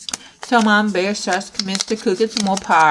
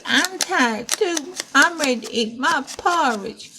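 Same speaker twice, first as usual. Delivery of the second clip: put-on Baby Bear; 7 semitones higher than usual. Baby Bear tells parents he is hungry, high and tired. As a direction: voice a tired little cub, pitched up.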